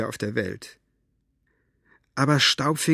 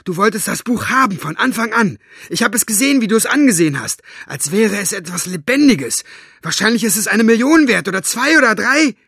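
loud, high, accusing outburst; angry accusation; 9 semitones higher, 7 dB louder than usual. Acted. Girl shouting accusations at her aunt. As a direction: hurl the blame at her.

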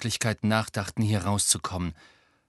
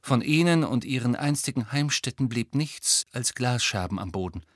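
usual speaking voice

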